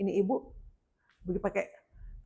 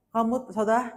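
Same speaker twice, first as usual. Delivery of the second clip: normal talking voice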